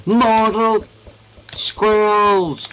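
high loud mock war cry; hammed-up battle cry. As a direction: bellow it like an epic villain. Dramatic in-game exclamation.

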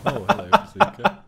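high, loud laughing hello; high, loud giggly greeting. Real, not acted. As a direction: laugh out the words in glee. Laughs as bazooka appears.

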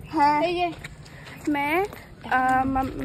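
ordinary talk, nothing marked

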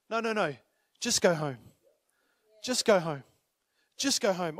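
low, gentle dismissal; gentle Jesus. Pretend. Jesus gently sends him home.

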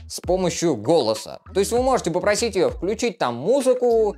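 ordinary talk, nothing marked

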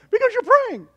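high, loud mocking mom voice; worried mom. Son imitating mom worried that he prays, high. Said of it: "my mom, you know, she just".

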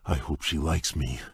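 ordinary delivery

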